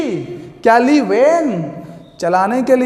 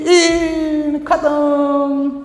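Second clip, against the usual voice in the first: electric shock cry; 3 semitones higher, much slower than usual. Shown as a demonstration. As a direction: mimic someone getting a current. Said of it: "जब current लगता है तो ई". (Current लगने की नकली चीख)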